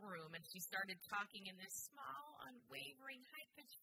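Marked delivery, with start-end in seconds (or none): 1.9-3.8 lightly imitated small voice, higher and softer; hint at a tiny character, pitched up and soft; half-attempted tiny, high voice. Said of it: "I can't do it like Marcel".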